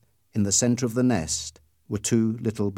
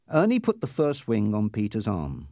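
usual speaking voice

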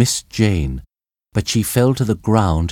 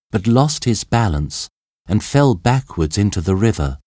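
ordinary delivery, nothing marked

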